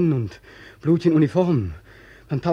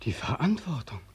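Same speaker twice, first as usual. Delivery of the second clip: breathy whisper, much softer; 5 semitones lower, 9 dB quieter than usual. Acted. Beckmann whispering to the colonel what he brings back. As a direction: hush the word like a secret. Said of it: "whispered reply to the colonel".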